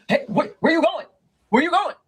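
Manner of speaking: high, loud pleading call from a car; calling after her. Retold or imitated. Boyfriend calling from car window.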